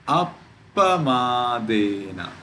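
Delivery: Appamadena slow, stopped at ap before pamadena; pause on double consonant. Shown as a demonstration. Teacher giving an example, Appamadena with a held p. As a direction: hold the pp of Appamadena. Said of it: "It is appamadena".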